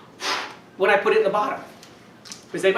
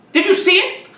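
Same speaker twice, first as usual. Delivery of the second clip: much higher and louder, wide-eyed show voice; excited; 13 semitones higher, 8 dB louder than usual. Acted. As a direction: sell the little wonder to the kids. Showing balloon inflate to campers.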